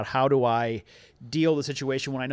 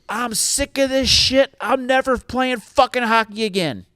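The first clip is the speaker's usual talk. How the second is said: imitating an angry kid's high-pitched yell; a mock shrieking tantrum, pitched way up; son's outburst; 10 semitones higher, 4 dB louder than usual. Twelve-year-old son shouts at dad after losing, mock angry yell.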